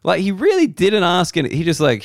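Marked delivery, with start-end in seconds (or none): none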